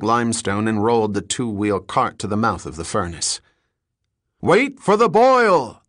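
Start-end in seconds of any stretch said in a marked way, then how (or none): 4.4-5.8 high, slow, loud bellow. Acted. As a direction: bellow the warning across the mill. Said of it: "the foreman bellowed".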